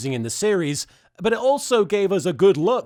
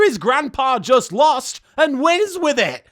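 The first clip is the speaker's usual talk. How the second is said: higher, louder and slow disbelief; exasperated rant; 6 semitones higher, 4 dB louder, slower than usual. Acted. Reviewer ranting at the nonsense plot, exasperated. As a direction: ask it like the world's gone mad.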